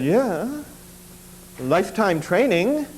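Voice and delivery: lightly imitated Korean accent, unhurried; a hint of the Korean master's accent, matter-of-fact and slow. Master tells the student the cure, in a light Korean accent. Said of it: "still struggling with broken English".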